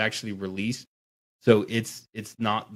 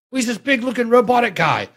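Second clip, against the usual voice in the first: very high, loud mock explainer; hammed-up director explaining; 8 dB louder than usual. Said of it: "the direction was like".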